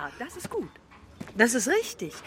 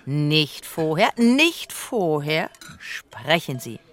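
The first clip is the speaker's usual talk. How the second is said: sehr langsam, jedes Wort auseinandergezogen; gedehnte Regieanweisung; 2 semitones lower, much slower than usual. Retold or imitated. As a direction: das „nicht vorher“ immer langsamer wiederholen, wie ein Regisseur, der beschwört. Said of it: "hat er gesagt". (Leni zitiert Pabsts Anweisung, langsam und gedehnt)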